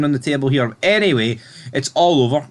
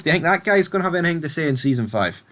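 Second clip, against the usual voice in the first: ordinary talk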